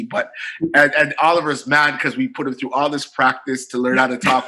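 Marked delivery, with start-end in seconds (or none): none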